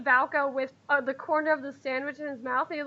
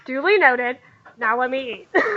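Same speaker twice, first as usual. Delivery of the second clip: loud, offhand brush-off; dismissive; 7 dB louder than usual. Acted. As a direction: wave her off and get back to eating. Valco brushes off Holly.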